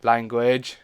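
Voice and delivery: loud sharp rebuke; loud scold. Acted. Scolding her younger self for swearing, loudly. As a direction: bark it out as a telling-off.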